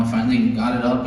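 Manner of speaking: ordinary talk, nothing marked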